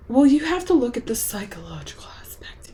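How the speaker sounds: whispered know-it-all critic; mocking whisper. Mocking critics who praise the psychological aspect, whispered. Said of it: "I'm so tired of, like".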